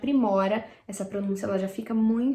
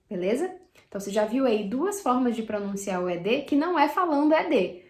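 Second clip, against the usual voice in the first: ordinary talk, nothing marked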